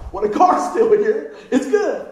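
slow, loud, through a laugh; mock reassured kid; laughing relief. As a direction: play the kid's silly relief. Child's relieved thought retold.

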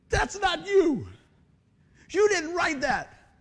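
high, loud emotional outburst; father moved to tears by his son; choked-up dad. Retold or imitated. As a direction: voice the father overcome. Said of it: "breaks down in my living room crying".

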